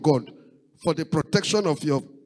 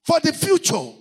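preaching; 7 semitones higher than usual. Acted. Pastor urging the congregation to pray ahead, rising preaching cry. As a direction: drive each line home to the congregation.

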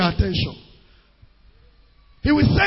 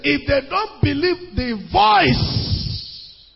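"voice" drawn out long and raised; stress on VOICE; much slower than usual. Shown as a demonstration. Preacher quoting to show the congregation the emphasis, stress on "voice". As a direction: lean hard and slowly on the last word, "voice". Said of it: "what is the emphasis?".

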